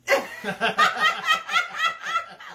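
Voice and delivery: a high rising burst of real laughter. Real, not acted. Laughing hard at his wife's teasing, a high real laugh. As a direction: laugh out loud, pitch climbing.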